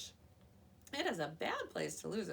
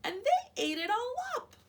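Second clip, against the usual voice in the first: much higher, loud squeak; high squeaky voice; 11 dB louder than usual. A narrator's reading. Baby Bear squeaking that her soup is gone. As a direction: squeak it out upset. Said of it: "in her high, squeaky voice".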